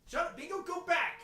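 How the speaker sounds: shouting. Acted. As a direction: yell it to shoo him. Shouting at character.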